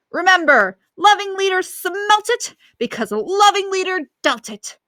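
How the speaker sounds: over-the-top mock dictator speech; impersonating Loving Leader. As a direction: ham up the megalomaniac dictator. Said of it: "doing my best impersonation".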